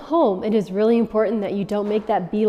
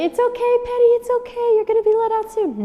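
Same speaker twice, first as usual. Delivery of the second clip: put-on much higher, cooing baby-talk voice; mock baby talk; 3 dB louder than usual. Owner soothing the crying dog, mock baby-talk coo. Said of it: "the second worst thing you could do is to put your finger through the crate and say".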